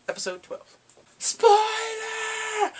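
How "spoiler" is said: high, loud drawn-out shout; spoiler warning. Pretend. Warning viewers before the reveal, high shouted alert. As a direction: holler a playful alarm.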